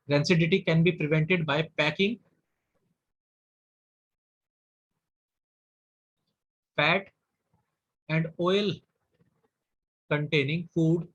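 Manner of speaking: dictating with long gaps between words; slow dictation. Shown as a demonstration. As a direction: give each word time to be written. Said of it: "dictating notes with long pauses". (रुक-रुककर लिखवाते हुए)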